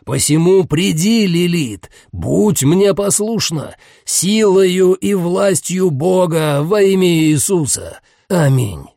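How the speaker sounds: slow, solemn summoning chant; solemn incantation. Acted. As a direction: intone the exorcist's summons slowly.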